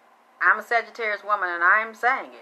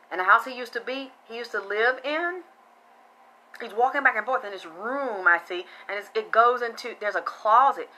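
ordinary talk, nothing marked

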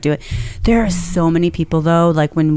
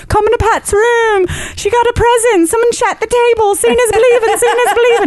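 much higher, hammed-up Irish calling out; retelling the coworker in a mock Irish voice; terrible Irish accent. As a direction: shout it down the hall in a bad Irish accent. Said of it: "That's a terrible Irish accent".

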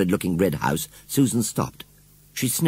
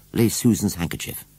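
ordinary delivery

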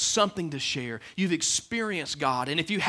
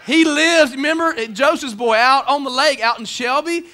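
loud, high, mocking build-up; loud joking emphasis; 8 semitones higher, 10 dB louder than usual. Real, not acted. Preacher jokes to congregation about Shelby, loud and mocking. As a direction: play up the small-town joke, loudly.

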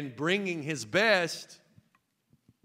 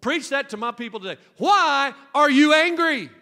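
voicing God preaching; 10 semitones higher, 10 dB louder than usual. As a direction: belt the question. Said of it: "he told me".

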